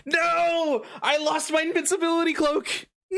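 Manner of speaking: shrieking high mock despair; megalomaniac rant. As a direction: wail it like a fallen villain. Loses cloak, mock despair.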